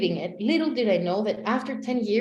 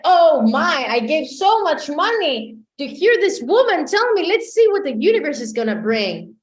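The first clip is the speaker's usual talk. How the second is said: loud, raised-pitch retold outrage; acted fury, easing toward the end; furious; 5 semitones higher, 7 dB louder than usual. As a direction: rant indignantly about wasted money. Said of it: "I was furious".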